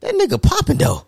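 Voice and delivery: squeaky high impressed voice; high mock voice. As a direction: pitch way up like a gossip. Voicing onlookers' verdict.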